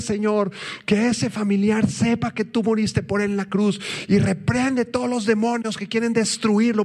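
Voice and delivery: high, urgent pleading prayer; crying out in prayer. Retold or imitated. Retelling a group prayer for a relative, crying out urgently. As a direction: plead with God in a rapid rush, pitched high. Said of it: "así estábamos clamando".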